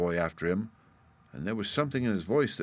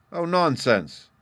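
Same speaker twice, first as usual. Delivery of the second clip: high, louder indignant retort; angry; 8 semitones higher, 6 dB louder, slower than usual. A narrator's reading. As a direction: dismiss it with a flash of temper. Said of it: "replied the boy angrily".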